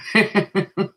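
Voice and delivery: short amused laugh. Real, not acted. Reader laughing after voicing the viewer, laugh. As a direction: laugh at her own skit.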